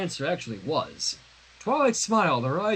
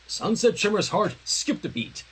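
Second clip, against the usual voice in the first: ordinary delivery, nothing marked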